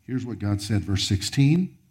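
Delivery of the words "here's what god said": ordinary talk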